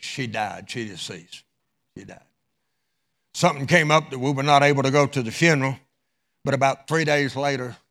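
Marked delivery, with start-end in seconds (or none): 0.0-2.2 solemn hush. Acted. Solemn news of a death.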